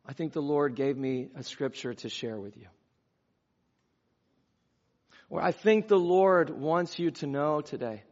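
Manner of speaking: plain, softened sincere offering; gentle, real, tentative delivery; humble, gentle. Shown as a demonstration. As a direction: share it modestly, low and unforced. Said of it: "we say it with a certain kind of humility".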